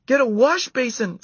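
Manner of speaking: high loud yell; yelling girl. A narrator's reading. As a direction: yell it across the cabin. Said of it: "someone yelled".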